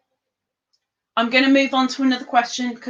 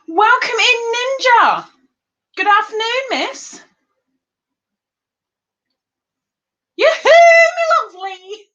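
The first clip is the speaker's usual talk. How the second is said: sing-song greeting; 11 semitones higher, 7 dB louder than usual. Acted. Greeting new viewers as they arrive.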